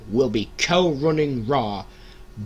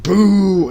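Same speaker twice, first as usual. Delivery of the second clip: crowd boo; 8 semitones higher, 11 dB louder than usual. Retold or imitated. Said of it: "everyone went".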